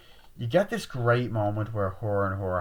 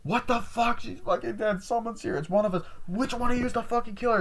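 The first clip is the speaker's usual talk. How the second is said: very high, strained mock panic; frantic accusation; 13 semitones higher than usual. Retelling friends suspecting each other, frantic mock accusation. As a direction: accuse the group in high panic, stretching the final question.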